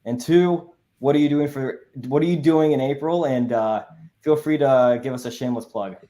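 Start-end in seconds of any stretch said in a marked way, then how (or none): none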